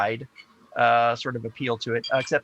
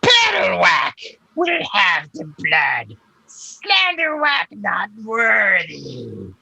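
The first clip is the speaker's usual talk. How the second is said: high, loud, slow creature cry; shouted monster, long stretched words; second monster voice; 7 semitones higher, 5 dB louder, much slower than usual. Acted. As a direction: wail it out across the cavern. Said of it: "you hear another voice say".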